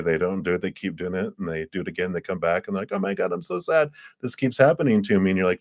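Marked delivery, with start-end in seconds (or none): 2.9-5.3 high-pitched, put-on woe-is-me voice; hammed-up, high mock lament; mock complainer. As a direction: imitate a friend moaning about their troubles. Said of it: "then they complain about something again".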